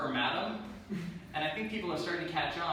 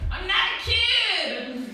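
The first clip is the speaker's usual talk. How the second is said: put-on higher, louder, slow offended child; indignant kid; 8 dB louder, much slower than usual. Kid correcting the daycare worker, indignant higher and louder. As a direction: protest like an insulted five-year-old. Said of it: "immediately he's like".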